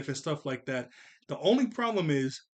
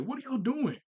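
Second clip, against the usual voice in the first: puzzled parent; 9 semitones higher than usual. Retold or imitated. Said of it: "thinking slash saying to".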